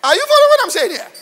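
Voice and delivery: high, fast shout; shouted question. Real, not acted. Preacher shouts the question at his congregation, loud. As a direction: fire the question at the crowd. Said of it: "preacher shouts to congregation".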